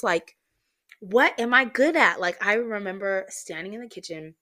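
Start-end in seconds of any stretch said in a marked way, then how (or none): 1.0-2.2 raised, higher voice, mock outburst; retold upset yelling. Venting to a friend in the kitchen, retold yelling. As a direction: re-enact an upset outburst, without real tears. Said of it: "I'm, like, crying and yelling".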